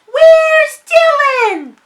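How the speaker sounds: calling out. Acted. Mother calling out for lost duckling.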